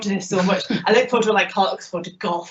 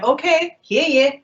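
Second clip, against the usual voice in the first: breezy dismissal; 7 semitones higher, 5 dB louder, slower than usual. Retold or imitated. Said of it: "I will be like".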